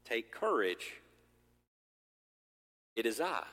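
low, gentle and reassuring; Jesus calming his frightened disciples, gently reassuring; calm reassurance. Acted. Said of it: "Jesus immediately said to them".

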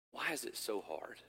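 normal talking voice

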